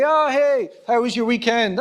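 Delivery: high, loud small-talk hello; chirpy greeting. Acted. Churchgoer greeting another at a get-together, chirpy and loud. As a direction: greet a church acquaintance with breezy cheer.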